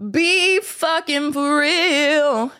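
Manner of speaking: slow, high disbelief; dragged-out high be for real; incredulous. Real, not acted. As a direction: say it like you can't believe it. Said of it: "incredulous retort".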